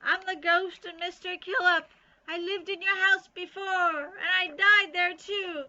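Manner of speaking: high, loud, scratchy ghost voice; spooky ghost howl. A narrator's reading. Bean pretends to be a ghost to scare Ivy, scratchy howl. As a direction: howl the word out like a haunting spirit. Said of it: "she howled".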